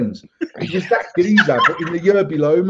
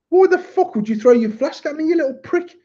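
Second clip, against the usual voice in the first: retold angry yell; 11 semitones higher than usual. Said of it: "And I've gone".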